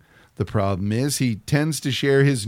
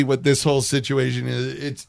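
normal talking voice

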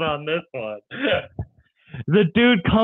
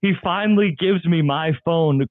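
usual speaking voice

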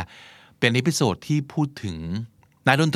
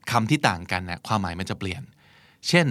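usual speaking voice

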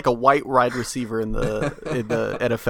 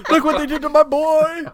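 mock wail; 13 semitones higher, 3 dB louder than usual. Mock grieving parent cries out.

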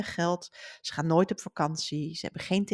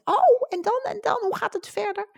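put-on much higher, pleading kids' voice; eager children; 4 dB louder than usual. Children begging the author to continue, high eager pleading. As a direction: beg for more like an eager child. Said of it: "dan zeggen de kinderen altijd".

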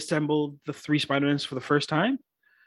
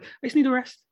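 mimicking Aunt May; 5 semitones higher, faster than usual. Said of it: "who's just like".

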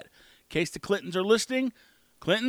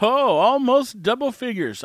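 louder, higher, slow drawn-out reply; retold response, raised and slow; imitated reaction; 6 semitones higher, 7 dB louder than usual. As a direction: stretch it out, mimicking an impressed reaction. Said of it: "He's like".

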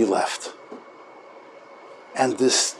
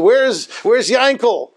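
higher, slow acted question; concerned searching rabbi; 6 semitones higher, slower than usual. Rabbi asking after the missing orphan, concerned. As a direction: imitate a rabbi looking for a missing child. Rabbi finds an empty bed.

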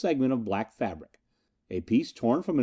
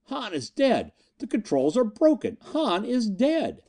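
high, panicked cry; shrill-pitched panic without full volume; screamed alarm; 10 semitones higher than usual. A narrator's reading. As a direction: scream the news in panic, drawing out the end. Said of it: "came like a scream".

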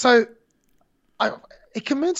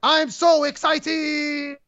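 very slow, higher, stretched like a mild mock yell; mock overexcited scream; 7 semitones higher, much slower than usual. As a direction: overact the excitement like a weirdo. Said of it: "Jiro just screams for no reason".

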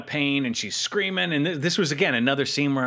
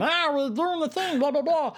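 much higher buzzy insect robot voice; Waspinator voice; 13 semitones higher than usual. Retold or imitated. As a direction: squeak it out like a high, silly wasp robot. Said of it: "Waspinator saying".

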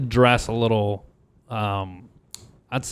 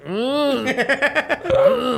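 high, dazed mumbling; mock drunk teacher grunts; 13 semitones higher than usual. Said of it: "She's like".

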